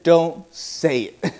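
low, slow inner voice; inner warning voice. Retold or imitated. An inner voice warns him not to say Dad, slow and low. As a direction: warn yourself in a slow undertone. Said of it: "something inside of me said".

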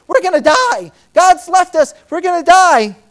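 high, loud cry of panic; panicked disciples. Retold or imitated. Disciples crying out in a sinking boat, panicked and loud. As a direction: yell it like men sure they'll drown.

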